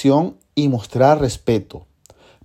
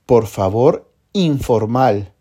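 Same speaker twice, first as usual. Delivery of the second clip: usual speaking voice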